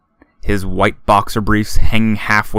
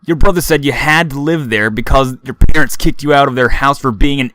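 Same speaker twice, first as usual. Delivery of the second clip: loud, higher sneering bully; mocking frat boy; 4 semitones higher, 5 dB louder than usual. A narrator's reading. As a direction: taunt him, cruel and smug. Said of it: "Freckled frat boy says".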